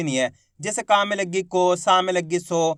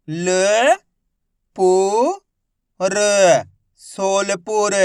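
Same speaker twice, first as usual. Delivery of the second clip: syllable reading drill; much slower than usual. Shown as a demonstration. Teacher sounds out words for young pupils, slow. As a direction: sound out each piece for children.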